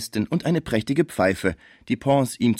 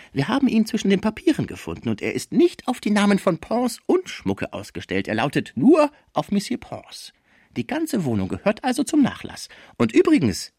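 much higher-pitched character voice; schrecklicher Fraisier; 13 semitones higher than usual. Acted. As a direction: play the dreadful lawyer, pitched high. (schrecklicher Anwalt, hohe Stimme)

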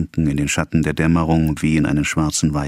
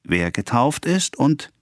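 high, slow catechism answer; lightly voiced boy reciting by rote; clear-voiced boy; 8 semitones higher, slower than usual. A narrator's reading. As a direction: give the boy's drawn-out recited reply. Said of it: "die hellen Antworten der Knaben".

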